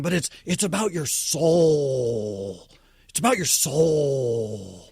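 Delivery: "soul" drawn out low and slow, as if deeply profound; mock profound emphasis. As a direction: intone "It's about your soul" like a grand revelation. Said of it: "emphasize the word soul as if it was".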